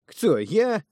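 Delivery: high, loud indignant squeak; who, me? shot up high in outrage; outraged surprise. Acted. Thug stunned by his brother's insult, high and loud.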